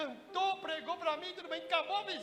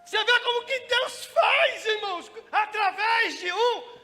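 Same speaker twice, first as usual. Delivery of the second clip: preaching; 4 semitones higher, 9 dB louder than usual. Acted. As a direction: proclaim it to the church. Preaching to the congregation.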